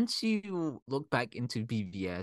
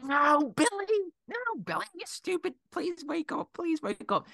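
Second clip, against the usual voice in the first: very high-pitched mock wail; hammed-up begging, sliding down toward the end; mock desperate pleading; 3 dB louder than usual. Everyone begging Billy to wake up, mock anguish.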